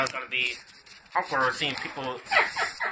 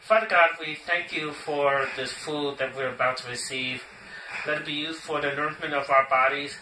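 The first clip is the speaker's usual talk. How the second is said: solemn family blessing; 3 semitones lower than usual. Acted. Leads a grace before eating.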